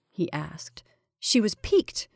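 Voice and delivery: ordinary delivery, nothing marked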